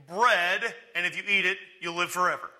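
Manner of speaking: loud, punched-up announcement; animated acted proclamation; enthusiastic offer. Acts out Jesus offering bread.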